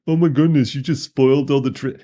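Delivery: high, loud mock outrage; mock whining complaint. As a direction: imitate an upset viewer protesting loudly. Mock complaint of an imagined viewer.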